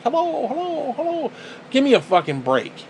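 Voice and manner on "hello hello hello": high, far-off echoing hello; mock echo bouncing back. Man mocking an echo in a big space, high and hollow. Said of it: "It's like".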